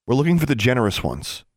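high, forthright announcement; announcing. A narrator's reading. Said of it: "Alexandra announced".

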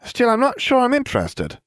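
very high, quick, airheaded voice; squeaky airhead voice; ditzy blonde witch. A narrator's reading.

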